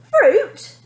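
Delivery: high, loud outraged question; a spoilt girl outraged at the word fruit; indignant. A narrator's reading. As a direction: spit it back in disbelief. Girl starts her tantrum.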